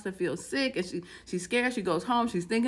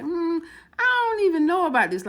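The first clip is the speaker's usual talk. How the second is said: higher, louder hesitant protest; doubtful reluctance; 7 semitones higher, 5 dB louder than usual. Retold or imitated. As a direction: balk at the idea, pitch jumping up. Woman hesitating over his plea.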